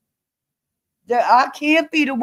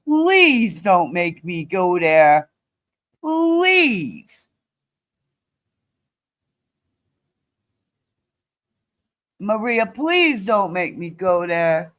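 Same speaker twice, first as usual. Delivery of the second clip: slow plea with a long drawn-out "please"; real strained, slow appeal; pleading warning; 4 semitones lower, slower than usual. Real, not acted. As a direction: beg as a warning, holding back. Warning Maria not to push.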